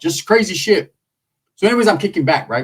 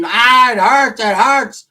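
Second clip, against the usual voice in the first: higher, louder hammed-up cry of pain; mock screaming aunt; 8 semitones higher, 4 dB louder than usual. Aunt complaining about her pinched nipple, high mock scream. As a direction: ham up the aunt's complaining. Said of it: "So she's over there screaming".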